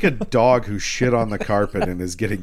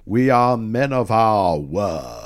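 slow, mock-regal pronouncement; King Arthur; slower than usual. Arthur holding to his men's promise, slow mock-regal voice. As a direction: intone it slowly as a solemn king. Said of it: "But King Arthur's like".

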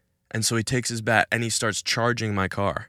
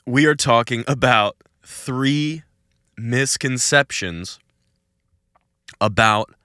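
slow emphasis; 3 semitones higher, 6 dB louder, slower than usual. Shown as a demonstration. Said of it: "announcing topic emphatically".

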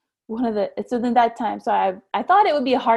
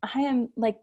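normal talking voice

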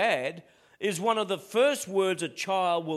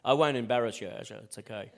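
ordinary talk

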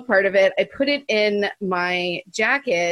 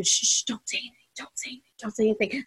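fast, urgent whisper; whispered frantic shushing; 6 dB quieter, much faster than usual. Retold or imitated. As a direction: hush them under your breath, rapidly. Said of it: "another person whispering".

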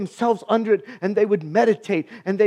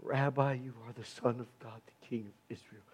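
much softer and lower, a hushed confession; quiet awe; 12 semitones lower, 17 dB quieter than usual. Acted. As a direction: breathe it like a private confession.

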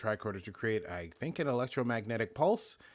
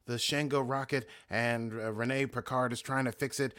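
ordinary talk, nothing marked

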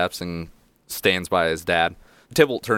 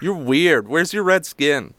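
put-on high, loud little-kid voice; blunt small child; 9 semitones higher, 4 dB louder than usual. Halfling child challenges Finny, in a high child voice.

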